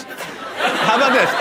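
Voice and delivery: normal talking voice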